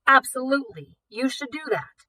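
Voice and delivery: a hint of a blustering official; forced authority. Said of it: "forced authority in his voice as he barked".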